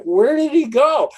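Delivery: high, loud mock-worried cry after the guide; puzzled call. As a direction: call out as if lost in the jungle. Lost in jungle, calling after guide.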